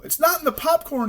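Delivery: very high, loud panicked shout. A narrator's reading. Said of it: "he shouted".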